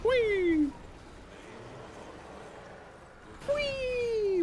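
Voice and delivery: very high, squealing whee; gleeful squeal. Real, not acted.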